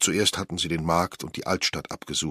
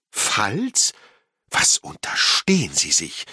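loud, affronted retort; indignant outburst; outraged; 4 semitones higher, 5 dB louder, slower than usual. A narrator's reading. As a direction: throw the word back high, then scold loudly. Angelina outraged at the word "if.

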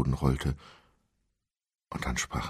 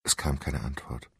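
plain reading voice

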